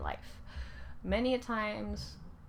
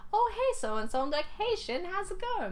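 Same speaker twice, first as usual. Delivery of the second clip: much higher, bright hallway hello; cheery greeting; 11 semitones higher, 4 dB louder than usual. Retold or imitated. Two teens meeting in the school hallway, bright high greeting. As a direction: greet an old friend happily.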